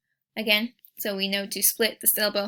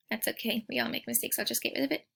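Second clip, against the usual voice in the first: soft aside; 7 dB quieter, faster than usual. Real, not acted. Reassuring aside after mistake.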